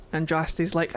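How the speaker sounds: normal talking voice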